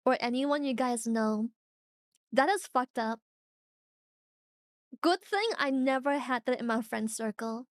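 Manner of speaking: ordinary delivery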